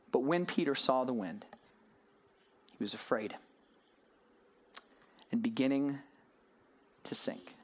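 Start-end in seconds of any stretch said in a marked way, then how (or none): 2.8-7.5 whispered as he goes under; dramatic whisper. Acted. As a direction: drop to a whisper on the last words. Narrating Peter sinking.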